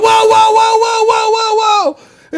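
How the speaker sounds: high, loud panicked whoas. Retold or imitated. Patient yelling at nurse with needle, high and panicked. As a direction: recoil from the needle, yelling whoa. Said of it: "and I went".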